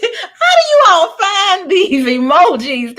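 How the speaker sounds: high, loud laughing disbelief. Real, not acted. Reacting to chat emojis, laughing hard. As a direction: squeal through laughter at the chat.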